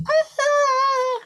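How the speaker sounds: high, loud mock scream of fright; child's terrified cry at the oogie bear; frightened scream. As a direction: shriek as if a monster jumped out. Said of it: "I didn't go".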